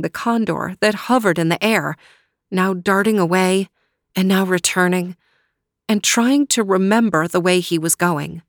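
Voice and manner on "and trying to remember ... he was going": plain reading voice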